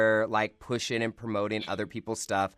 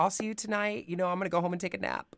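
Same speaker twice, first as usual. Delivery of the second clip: higher, quick casual excuse; lightly voiced excuse; 11 semitones higher, faster than usual. Telling family she is off for a nap, quick and light. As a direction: toss it off lightly as you slip away.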